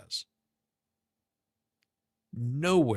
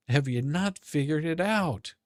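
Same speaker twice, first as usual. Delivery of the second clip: usual speaking voice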